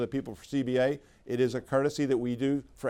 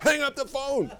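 much higher, exasperated mock command; exasperated order; 12 semitones higher than usual. Boss catching an employee yelling at a caller, exasperated order. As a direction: order her off the phone in disbelief. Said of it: "I'm like".

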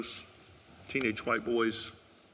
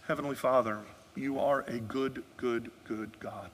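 closing prayer; 6 dB quieter than usual. Acted. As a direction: pray gently over the people.

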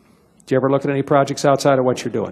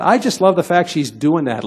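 ordinary delivery

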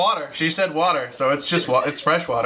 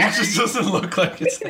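said through a laugh; laughing while repeating it; 6 semitones higher than usual. Real, not acted. Echoing the line while laughing.

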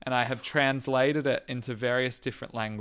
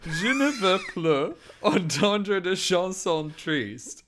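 slow, raised French through clenched teeth; gritted-teeth French voice; 7 semitones higher, 4 dB louder, slower than usual. Pretend. Giving co-host the lyric again, gritted-teeth French voice. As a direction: put on an odd, drawn-out French voice. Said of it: "gritted together".

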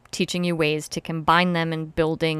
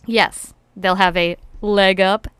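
ordinary delivery

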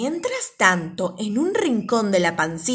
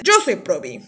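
lower, steady hero voice; composed, lower-pitched introduction; Probi introducing himself; 3 semitones lower than usual. Acted. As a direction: announce yourself as the general in charge. Probi introduces himself, Previ follows.

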